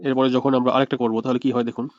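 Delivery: ordinary talk, nothing marked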